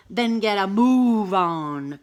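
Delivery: firm, slow bossy order; Cat orders the scared cow onward, slowly and firmly. Acted. Cat bossing Cow forward, slow firm order. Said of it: "Cat ordered".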